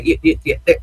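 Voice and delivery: tripping over the first word; stammering. Real, not acted. Host stuttering over shows they call crazy, stammering. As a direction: get stuck repeating a small word, flustered. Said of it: "I'm stuttering".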